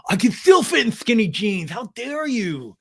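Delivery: high, mock-offended whine; Tom impression. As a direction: protest loudly, then stretch it out indignantly. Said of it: "Tom heard that and was like".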